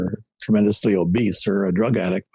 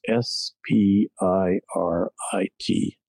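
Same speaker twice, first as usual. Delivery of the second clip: spelled out letter by letter; much slower than usual. Retold or imitated. Spelling out a word slowly.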